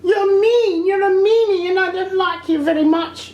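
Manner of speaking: very high-pitched petulant little voice; squeaky, sing-song mock huff; mock childish sulk. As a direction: mimic a feeble tantrum way up high. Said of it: "not the natural reaction of somebody who's angry".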